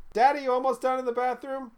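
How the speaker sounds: put-on high kid voice; child voice. Child knocking on the bathroom door for daddy. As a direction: play the impatient little kid.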